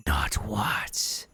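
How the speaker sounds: bitter accusing whisper from a ghost; ghostly whisper. Acted. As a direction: whisper the betrayal coldly.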